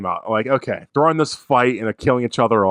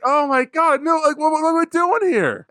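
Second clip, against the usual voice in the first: much higher, despairing whine; exasperated disbelief. Retold or imitated. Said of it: "And I was like".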